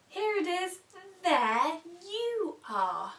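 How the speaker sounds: delighted reveal. Shown as a demonstration. Mirror reveal to toddlers.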